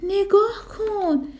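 lightly voiced child, slow and high with delight; a little elephant thrilled with her hat, drawn out; excited child. Little elephant calling her mother to look, excited.

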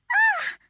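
sharp high shriek of alarm; mother's startled scream. A narrator's reading. Mother seeing her child up high, startled shriek. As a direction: let out a shocked shriek. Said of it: "مادر جیغ کشید".

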